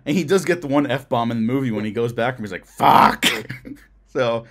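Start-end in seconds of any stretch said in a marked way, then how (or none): 2.6-3.2 mock curse. Retold F-bomb in movie.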